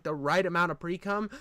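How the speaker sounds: ordinary talk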